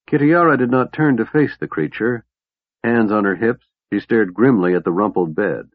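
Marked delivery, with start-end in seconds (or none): none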